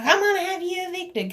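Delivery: quick, high mocking imitation; mimicked threat. Quoting the woman who threatened eviction, mockingly.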